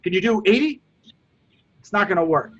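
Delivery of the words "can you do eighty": fake, robotic ask. Shown as a demonstration. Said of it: "If it's fake".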